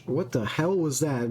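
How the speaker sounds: ordinary talk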